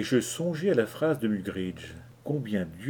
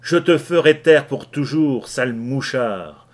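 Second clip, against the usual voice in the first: threatening; 3 semitones higher, 9 dB louder than usual. Acted. Kelly threatens the informer.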